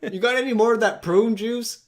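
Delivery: high, loud comic holler; mock yelling from the background; yelling off the phone. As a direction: shout a casual request across the room. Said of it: "yelling from, like, off the phone".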